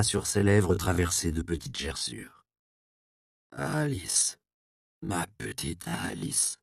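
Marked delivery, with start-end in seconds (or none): 3.5-6.6 put-on soft, gravelly old man's mumble; grinding rumbling rock voice. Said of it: "d'une voix rocailleuse qui forçait encore le trait de son accent étranger".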